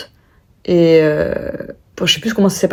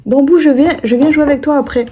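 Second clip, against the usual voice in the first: higher, louder call to the pet; real aside to the pet, pitched up; 8 semitones higher, 4 dB louder than usual. Real, not acted. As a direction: promise the pet playtime later. Aside calling to pet Bambou.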